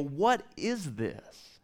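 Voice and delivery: lightly voiced bewildered wanderers; puzzled Israelites. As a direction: ask slowly as if baffled by strange food. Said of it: "they go".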